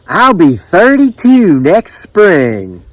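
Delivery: high, slow, drawn-out country voice; country Judith. A narrator's reading. Judith giving the islander her age, high slow drawl. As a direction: stretch the answer out proudly.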